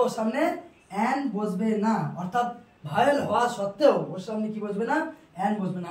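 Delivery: ordinary delivery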